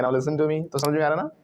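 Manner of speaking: ordinary talk, nothing marked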